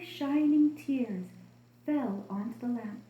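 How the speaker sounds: usual speaking voice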